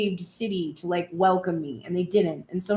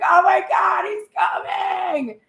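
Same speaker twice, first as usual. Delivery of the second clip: much higher, half-shouted excitement; giddy squeal; 10 semitones higher, 4 dB louder, slower than usual. Retold or imitated. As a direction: squeal like he is at the door. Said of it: "and you're like".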